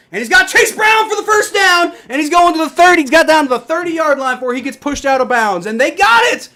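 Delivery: very high, very loud touchline yell; excited shout. Real, not acted. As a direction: shout the big play as it happens. Shouted call of first down.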